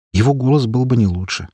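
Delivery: ordinary delivery, nothing marked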